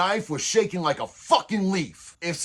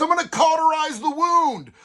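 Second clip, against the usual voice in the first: excited claim; 7 semitones higher, 3 dB louder than usual. Retold or imitated. Friend's absurd confident plan.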